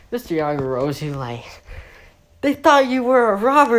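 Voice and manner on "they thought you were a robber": high, loud acted scolding; mock exasperated yell. Teacher telling off a clueless student, exasperated scolding.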